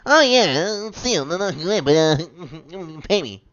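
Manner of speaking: high, loud mock landlord voice; hammed-up impression of a shameless landlord. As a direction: sneer it out like a greedy landlord. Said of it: "This guy".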